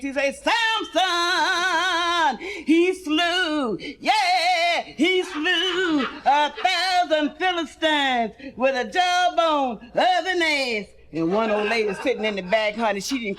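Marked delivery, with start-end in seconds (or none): 0.4-10.9 preacher voice. Acted. Preacher singing out to the congregation. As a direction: stretch it like a Sunday preacher. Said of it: "he was preaching so fast".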